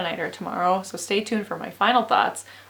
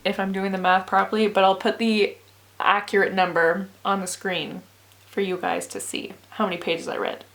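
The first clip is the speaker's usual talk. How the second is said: normal talking voice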